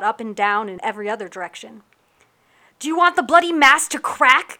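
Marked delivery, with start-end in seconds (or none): 2.8-4.6 loud, high shout over the storm; shouted over the din. Acted. Captain to her crew in a storm, furious shout. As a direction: bellow it over howling wind and waves. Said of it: "Captain Yuki shouted over the din".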